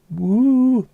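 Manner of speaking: high, loud woo of a football chant. Shown as a demonstration. Demonstrating the Goodison Park woo, loud and high. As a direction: let out a big high woo from the stands. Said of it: "I will demonstrate".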